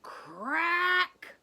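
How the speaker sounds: loud sharp snap of a branch; crack sound. Acted. Reader acting out a branch snapping, loud crack. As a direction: bark out the crack like a breaking branch.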